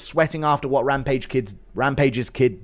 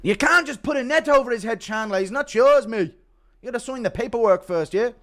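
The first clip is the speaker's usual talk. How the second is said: higher put-on Irish-flavoured voice; mocking Connor, lecturing; Connor impression; 7 semitones higher than usual. Connor telling Chandler the kid isn't his, higher put-on voice. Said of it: "Impression of Connor telling Chandler".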